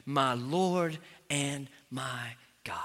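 slow, hushed confession; slow reverent quote; reverent proclamation. Acted. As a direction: savor each word softly. Quotes key verse slowly.

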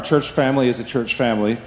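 usual speaking voice

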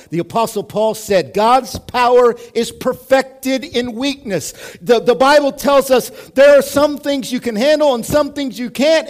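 high, loud, driving sermon voice; full-force preaching. Real, not acted. Preacher telling church of surrendering to God, full force. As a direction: preach it out to the whole room.

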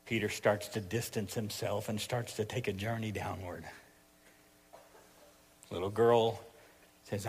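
low, soft, sombre narration; hushed sermon storytelling. A narrator's reading. Preacher telling of the night Jesus was arrested, hushed. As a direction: let the voice sink gravely with the story.

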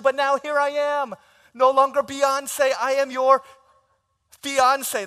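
high, loud gushing acceptance; gushing yes. Retold or imitated. Bride accepting the proposal, gushing high and loud. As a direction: swoon out yes yes yes.

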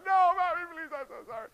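high, pleading whimper; mock crying kid. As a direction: sob out the apology. Grown kid cries.

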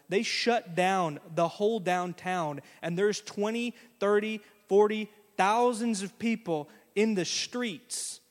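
usual speaking voice

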